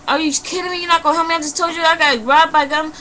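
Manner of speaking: high, incredulous protest; indignant disbelief. Retold or imitated. Robbed customer confronting donut shop lady, indignant disbelief. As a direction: re-enact outrage, pitch pushed well up.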